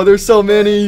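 usual speaking voice

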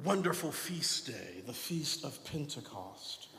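hushed mock challenge from the pews; imagined doubting listener. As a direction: voice the congregation's doubt quietly. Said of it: "you may be asking".

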